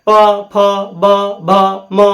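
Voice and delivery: प, फ, ब, भ, म very slowly, each separate; lip-sound demo. Shown as a demonstration. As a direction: pop प, फ, ब, भ, म off closed lips, slowly. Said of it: "बोलिएगा तो ओठवा दोनों सट रहा है". (प, फ, ब, भ, म धीरे-धीरे, होंठ सटाकर)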